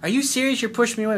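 mock whiny girlfriend. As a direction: play the offended girlfriend for laughs. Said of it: "she bitches at you and goes".

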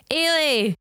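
high sing-out of a name; calling upstairs. Acted. Acting wee figure calling upstairs.

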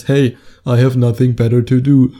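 hammed-up goofy loafer voice; mock aimless guy. Guy approaching a girl without a goal, mocking goofy voice. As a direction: play a clueless guy with time to kill.

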